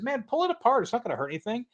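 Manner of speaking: high-pitched quote of himself urging a buddy on; urging, retold. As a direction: pitch it up high, egging him on. Retells his urging the man on.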